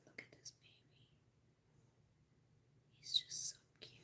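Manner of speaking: tender murmured whisper. Real, not acted. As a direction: breathe it so he doesn't wake. Whispering over the baby.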